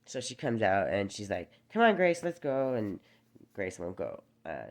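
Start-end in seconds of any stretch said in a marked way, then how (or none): 1.7-2.7 much higher and louder, fake-cheery call; imitating a babysitter's sugary, sing-song coax. Babysitter coaxing the scared girl to come inside, high, loud sing-song.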